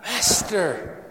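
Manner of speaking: slow, fearful cry to the Master; terrified plea. Shown as a demonstration. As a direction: say it like frightened men in a storm. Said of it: "Kind of picture us in that situation".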